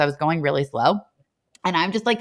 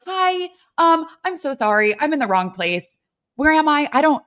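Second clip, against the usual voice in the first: higher-pitched flustered apology, easing down; 7 semitones higher than usual. Retold or imitated. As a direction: replay a flustered, apologetic stammer. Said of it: "getting flustered".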